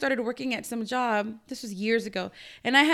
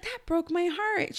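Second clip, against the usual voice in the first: softer and slow, a gentle hint of heartbreak; heartbroken; 5 semitones higher, 7 dB quieter, much slower than usual. Retelling her mother-in-law's reaction, softly sad and slow. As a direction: lightly voice a saddened mother-in-law, slow and soft. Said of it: "She was like".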